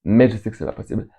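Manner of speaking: usual speaking voice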